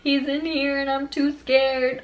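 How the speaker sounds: high, slow mock-tearful whine; imitated scared brother. Frightened brother telling police someone's inside, imitated whine. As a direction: draw it out like a frightened child. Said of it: "I remember him saying on the phone".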